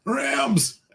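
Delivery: high, excited shout; cheering Rams. Real, not acted. Host hyping the Los Angeles viewer's team, excited shout.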